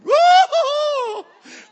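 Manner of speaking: loud, very high comic bawl; hammed-up howl of crying; mock crying wail. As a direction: let out a long exaggerated sob-wail. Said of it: "I started crying".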